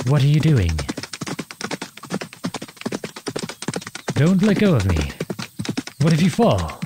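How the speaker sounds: urgent alarmed calls to a rider standing up. Acted. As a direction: call up to her in sudden fright. Do stands up on horse.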